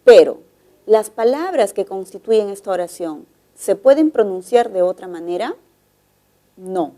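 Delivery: normal talking voice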